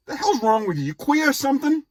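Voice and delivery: high, loud imitated dad shouting; mock angry yell. Dad yelling at his son over the earring, loud outraged mock shout. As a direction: blurt it out as an outraged father.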